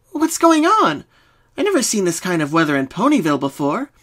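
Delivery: high, loud startled question; alarmed by the strange fog; confused alarm. A narrator's reading. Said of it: "Pinky asked, confused".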